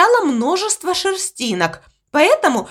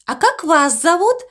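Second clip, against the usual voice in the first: squeaky voice; 5 semitones higher than usual. Acted. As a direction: squeak it out dreamily. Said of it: "полюбопытствовала она". (писклявый голосок)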